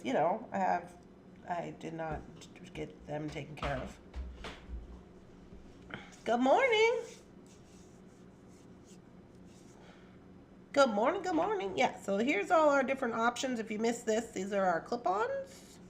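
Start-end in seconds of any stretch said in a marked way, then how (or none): 6.2-11.7 much higher sing-song hello; sweet pet greeting. Real, not acted. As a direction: coo a greeting to the animal.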